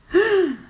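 sharp, high gasp; short startled intake, high and loud; frightened gasp. Acted. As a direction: gasp loudly in sudden alarm. Narrator gasps as crowd takes fright.

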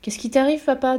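voix d'enfant plus aiguë, légère; petite fille faussement innocente. A narrator's reading. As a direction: questionne d'un air innocent. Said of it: "demanda-t-elle". (Mathilda taquine son père, l'air innocent)

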